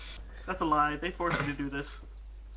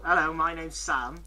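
ordinary delivery

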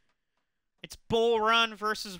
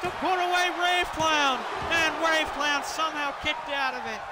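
high, excited play-by-play calling; wrestling commentator; 5 semitones higher than usual. Acted. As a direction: call the big moves hyped up, ringside. Live play-by-play of a wrestling match.

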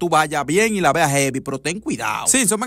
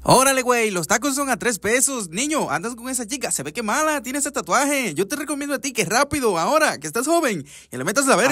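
a lightly voiced Mexican taco seller, higher; mock Mexican street vendor, Mexican accent throughout; 7 semitones higher than usual. Taco seller advising the boy, in a mock Mexican accent.

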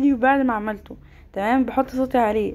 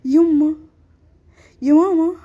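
frightened cry; 5 semitones higher, 6 dB louder, slower than usual. Real, not acted. Scared by NPC running off.

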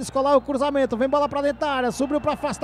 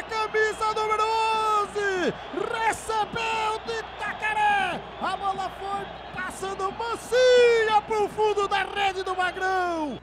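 explosive goal commentary; 5 semitones higher than usual. Real, not acted. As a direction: stretch the scorer's name in triumph. Naming the goal scorer.

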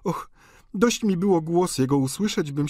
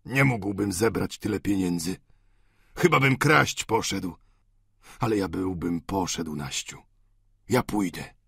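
put-on a ruined old father vowing he'd steal for her; old Goriot, desperate; 9 semitones lower than usual.

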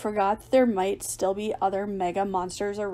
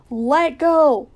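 loud, higher-pitched yell; screamed command; 4 semitones higher, 8 dB louder than usual. A narrator's reading. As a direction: scream it at an attacker. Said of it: "I screamed".

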